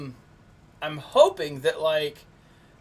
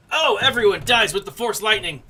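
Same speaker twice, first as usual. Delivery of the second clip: excited; 5 semitones higher, 6 dB louder than usual. Real, not acted. Reacting to game event.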